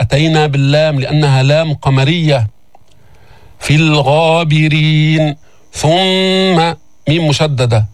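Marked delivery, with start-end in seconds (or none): 3.6-5.4 slow chanted verse; Quran recitation. Shown as a demonstration. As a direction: draw out the end. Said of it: "teacher recites verse".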